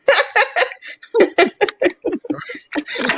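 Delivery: big burst of high laughter; loud laugh. Real, not acted.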